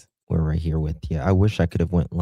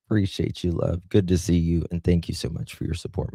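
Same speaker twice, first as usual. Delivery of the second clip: ordinary talk, nothing marked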